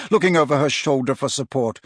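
ordinary delivery

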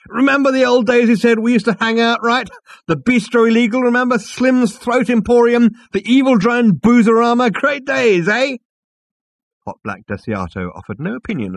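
loud, high, tipsy bonhomie; drunk loud greeting. A narrator's reading. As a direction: call out drunkenly across the room. Drunk Ford rambling loudly.

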